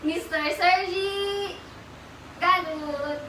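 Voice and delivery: drawn-out high announcer intro; grand introduction. Real, not acted. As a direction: present him like a star. Introducing best friend on camera. (engrandeng pagpapakilala)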